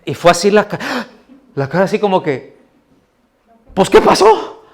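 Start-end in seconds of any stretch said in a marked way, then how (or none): 3.7-4.6 put-on high, baffled little-boy voice, louder at the end with a laugh; a small boy asking what went wrong, high and bewildered; puzzled child. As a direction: play the toddler stunned his trick failed. Toddler's tantrum trick failed.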